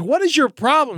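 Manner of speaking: high, pointed fuck you; angry mock retort. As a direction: snap it back at the snooty lady. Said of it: "I was like".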